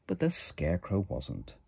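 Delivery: ordinary delivery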